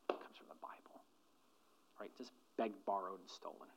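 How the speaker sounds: soft unvoiced whisper, confiding; hushed whisper to the congregation; whispered aside. Real, not acted. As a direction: let them in on a secret.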